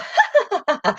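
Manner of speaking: high laugh; imitating her laugh. Owner showing the laugh she does for her parrot. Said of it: "I go".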